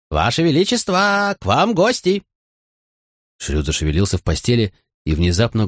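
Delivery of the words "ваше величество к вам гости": high, slow, drawn-out jester call. Acted. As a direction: call out to wake the king. Fool calls the king again. (протяжный высокий шутовской оклик)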